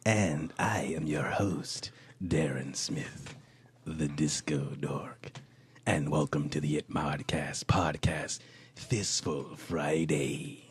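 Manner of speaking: low breathy podcast whisper; whispered intro. Acted. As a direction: whisper the welcome slowly.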